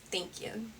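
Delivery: usual speaking voice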